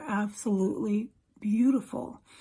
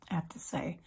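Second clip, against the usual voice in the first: breathy whisper to herself; whispered aside; 3 semitones lower, 9 dB quieter, faster than usual. Real, not acted. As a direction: whisper it under your breath. Quiet aside after praise.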